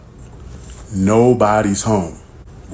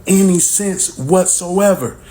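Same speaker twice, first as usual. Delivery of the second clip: ordinary delivery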